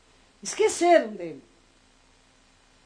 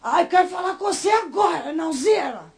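loud, raised call; urgent call; 5 semitones higher, 7 dB louder than usual. Retold or imitated. Cleaner interrupting the prayer meeting, loud and urgent. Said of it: "Aí ele falou assim".

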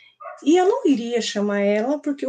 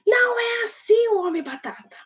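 high-pitched mock yell of protest; retold child's shouting; 11 semitones higher than usual. Boy objecting to teacher's potato man drawing, high mock yelling. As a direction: imitate a boy yelling a correction. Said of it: "começava a gritar".

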